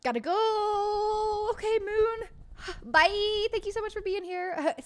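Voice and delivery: high, loud stretched-out goodbye; excited sing-song. Real, not acted. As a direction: sing it out to a friend leaving. Excited sing-song farewell greeting.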